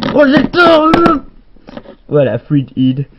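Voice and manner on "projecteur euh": much higher, louder squeaky prop voice; the spotlight answers in a silly high voice; cartoon spotlight piping up loudly; talking spotlight. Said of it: "monsieur le projecteur".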